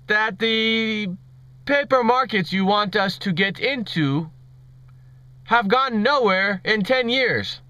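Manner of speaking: halting, badly faked Indian accent; hammed-up Indian accent, slowing at the end; terrible Indian accent. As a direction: play a questioner with a mock Indian accent. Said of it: "enough of my terrible Indian accent".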